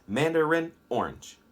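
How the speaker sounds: MANDARIN ORANGE in measured syllables; rhythmic demo. Shown as a demonstration. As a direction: say mandarin orange as a beat pattern. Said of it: "say it like that".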